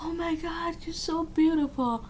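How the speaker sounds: mock awestruck. Stranger staring like seeing ghost.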